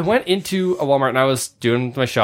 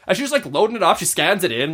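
ordinary talk

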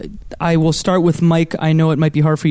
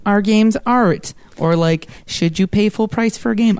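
higher-pitched mock-pondering voice; put-on debater voice; 5 semitones higher than usual. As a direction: pose the questions in a silly, higher voice. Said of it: "I don't know why I'm making this voice".

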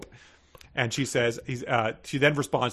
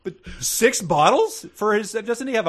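incredulous; 8 semitones higher, 4 dB louder than usual. Real, not acted. Incredulous about six bottles.

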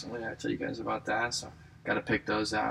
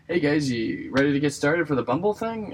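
higher, louder mock host addressing a room; raised, upbeat announcement to a waiting group; calling out; 6 semitones higher, 7 dB louder than usual. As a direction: pose as the organizer rallying everyone. Posing as staff addressing waiting room.

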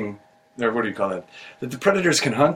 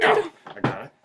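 high squeal. Acted. Grabs floating creature, high squeal.

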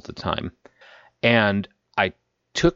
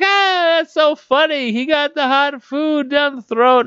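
mocking onlookers; 5 dB louder, slower than usual.

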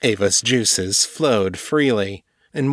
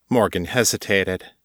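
ordinary delivery

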